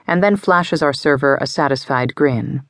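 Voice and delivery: plain reading voice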